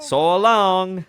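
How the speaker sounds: hammed-up mid-Atlantic accent. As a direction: call out like a departing mid-Atlantic newsman. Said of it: "like, a mid-Atlantic accent".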